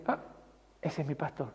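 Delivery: low, very soft voice of dawning recognition; hushed recognition. Acted. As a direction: voice a sheep softly recognizing its shepherd. Sheep recognizing their shepherd's voice.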